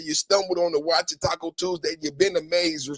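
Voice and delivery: usual speaking voice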